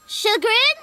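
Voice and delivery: high, loud questioning try; a high puzzled attempt; high chagrin. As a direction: squeak the word unsure.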